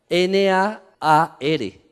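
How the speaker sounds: "N A A R" spelt letter by letter, very slow; spelling out Nahar. Shown as a demonstration. Preacher spelling the Hebrew word for the congregation, slowly. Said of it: "en hebreo significa Nahar".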